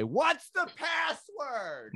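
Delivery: high, slow, far-off call through the door; mysterious gatekeeper voice. Retold or imitated. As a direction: stretch it out like a spooky doorkeeper. Hidden voice behind van door.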